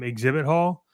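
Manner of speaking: usual speaking voice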